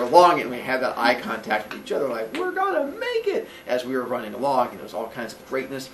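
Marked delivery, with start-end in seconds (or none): 2.4-3.5 hushed, very high squeal; mock little-boy squeak, kept soft; hopeful kid cheer. Boy to his brother as they race along, hopeful high squeak. Brothers bonding while running, acted.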